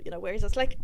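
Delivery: ordinary delivery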